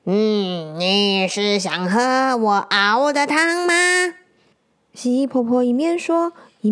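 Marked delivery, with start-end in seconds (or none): 0.1-3.7 put-on slow old grandma voice, teasing offer; a hint of a sly granny lizard; lightly voiced old granny. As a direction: tempt the child with soup.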